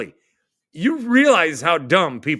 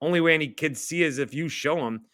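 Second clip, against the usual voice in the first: ordinary talk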